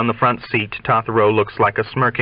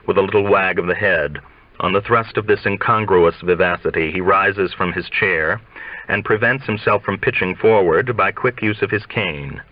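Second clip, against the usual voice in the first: ordinary delivery, nothing marked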